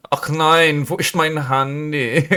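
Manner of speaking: high-pitched camp German accent. Shown as a demonstration. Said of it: "my German always goes to camp German".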